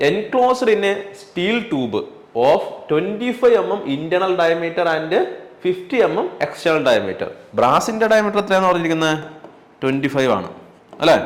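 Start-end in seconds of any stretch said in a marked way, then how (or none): 0.1-7.3 very slow, word by word; slow dictation. Shown as a demonstration. Teacher dictating a definition to students, very slow. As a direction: spell out the definition for notes. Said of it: "rereading problem slowly".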